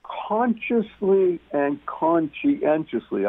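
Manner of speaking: "consciously and conscientiously" said slowly, each word weighted; slow, deliberate stress on "consciously and conscientiously"; heavy emphasis. Real, not acted. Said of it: "I can't emphasize this enough".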